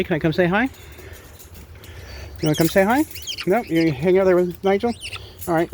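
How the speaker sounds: high, bright pet-talk voice. Real, not acted. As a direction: coo at the birds like toddlers. Talking to parrots Nigel, Marley.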